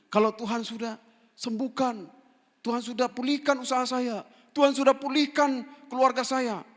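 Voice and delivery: loud, high, mounting declaration; impassioned preaching. Real, not acted. Preacher voicing believers' vows after God restores them, impassioned shout. Preaches a vow at full force.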